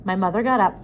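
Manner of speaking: ordinary delivery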